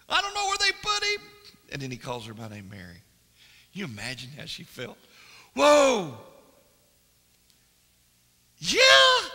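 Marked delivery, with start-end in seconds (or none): 0.1-1.3 much higher, louder voice of a weeping woman; Mary distraught. Acted. Mary telling Jesus she can't find his body, distraught high cry. 5.5-9.3 much higher, loud cry of joy; joyful shout. Acted. Mary recognizing Jesus at the tomb, ecstatic shout.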